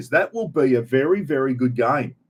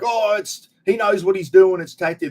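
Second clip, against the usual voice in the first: higher, mocking voice of a Trump backer; mock supporter; 6 semitones higher than usual. Mimicking people who defend Trump's tariffs, in a raised mocking voice. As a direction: lampoon the true believers, pitched up. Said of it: "It's like".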